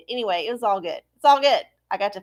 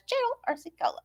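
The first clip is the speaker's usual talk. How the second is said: high voice; 13 semitones higher, 7 dB quieter, much faster than usual. Acted. Show sign-off.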